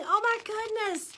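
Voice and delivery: ordinary delivery, nothing marked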